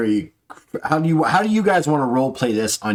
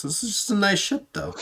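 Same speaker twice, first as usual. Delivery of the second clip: high, soft awkward remark; lightly voiced awkward guest; NPC voice; 10 semitones higher, 4 dB quieter than usual.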